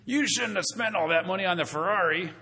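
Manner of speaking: lightly voiced nosy critic; mock scolding. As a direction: scold someone over a Ferrari, half-joking.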